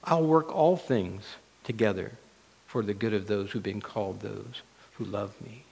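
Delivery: very soft, low reassurance; God's voice. Acted. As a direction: reassure quietly, sinking almost to a hush. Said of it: "And God's word says".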